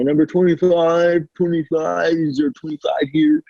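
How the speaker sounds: a lightly voiced clerk calling a number; bakery worker calling the next customer, mildly sing-song; mock counter call. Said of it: "call, you know".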